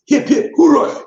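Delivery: high, loud put-on hurrah; mock cheer. Cheering the London listener, high mock cheer.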